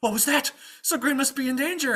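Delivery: high, loud worried squire; alarmed squire. Acted. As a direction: sound the alarm for your master. Squire fears master is endangered.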